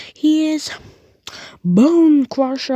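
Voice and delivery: high, loud, slow ring-announcer boom. Acted. Ring announcer presenting the champion, booming and slow. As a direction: bellow the name to the arena.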